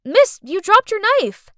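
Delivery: loud high call. Acted. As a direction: call after her across room. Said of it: "called out".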